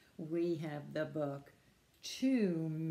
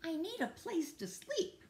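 high-voiced rabbit asking for help; Rabbit's plea; 10 semitones higher than usual. Rabbit tells Tortoise he needs a bed, high-voiced plea. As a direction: pitch it up, a needy little character.

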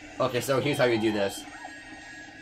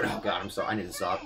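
sheepish apology; 6 semitones lower, 8 dB quieter than usual. Real, not acted. Catches himself after the yelling.

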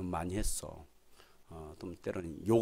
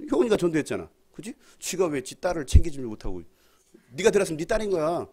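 mock scolding; 7 semitones higher, 9 dB louder than usual. Pastor scolding playfully in sermon.

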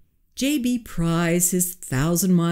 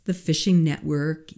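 ordinary delivery

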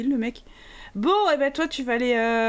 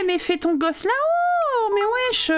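exasperated plea; 8 semitones higher than usual. Real, not acted. Scolding sim blocking the stairs.

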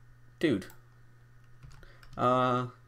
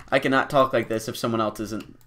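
normal talking voice

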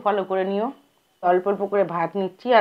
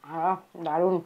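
eating rice with hand; talking while chewing. Real, not acted. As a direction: mouth full of food. Seen 1: Cheeks puffed and jaw working after a bite at -7.0.

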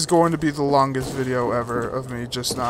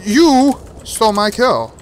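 indignant shout; 5 semitones higher, 7 dB louder than usual. Real, not acted. Kill stolen again.

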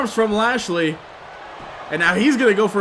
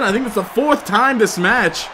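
normal talking voice

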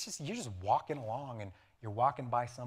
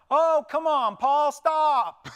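higher, louder whiny protest; hammed-up crowd pleading with Paul; mock exasperated crowd; 16 dB louder, much slower than usual. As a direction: play the fed-up crowd. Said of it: "think serious drama here".

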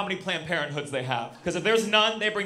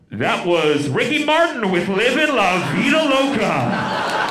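loud, high, drawn-out DJ announcement; radio DJ; 6 semitones higher, 8 dB louder, slower than usual. Acted. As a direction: ham up a radio DJ's song back-announce. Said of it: "the D J was like".

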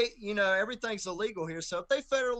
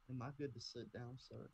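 barely audible, meek and much softer; quiet; 4 semitones lower, 17 dB quieter than usual. Retold or imitated. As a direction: murmur it timidly so the judge barely hears. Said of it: "I was quiet".

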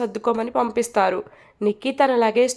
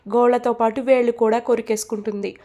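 plain reading voice

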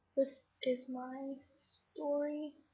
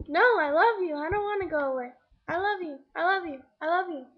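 loud, insistent retold pleas, each one swooping; mock lovesick pleading; 2 semitones higher, 6 dB louder than usual. Stalker begging the girl on the bike, mock lovesick. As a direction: plead like an obsessed admirer, mockingly. Retelling an obsessed suitor's pleas.

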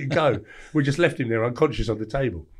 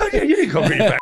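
much higher, louder sneering mock voice; mocking retort; 7 dB louder, faster than usual. Laughing at the Danish guy, high mock sneer. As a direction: taunt him in a high sing-song. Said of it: "I went".

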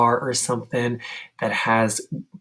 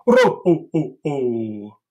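Santa ho ho ho; 3 dB louder, slower than usual. Shown as a demonstration. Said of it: "Santa belly".

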